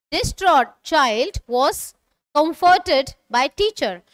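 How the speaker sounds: slow, deliberate reading for learners to repeat; slow model sentence. Shown as a demonstration. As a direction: read it slowly so students can follow. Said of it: "repeat after me". (धीमी, साफ़ पढ़ाई की आवाज़ में)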